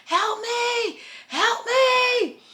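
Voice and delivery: very high, strained retold scream; mock scream for help. Running through the front gate at 3 a.m., screaming for help. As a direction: wail it up high like she's being chased. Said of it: "I am screaming to the top of my lungs".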